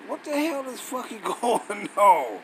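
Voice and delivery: laughing disbelief. Real, not acted. Finds verse not matching.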